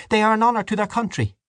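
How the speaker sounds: put-on high, stern old woman; pious scolding woman. A narrator's reading. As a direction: rebuke them piously. Dante defending priests.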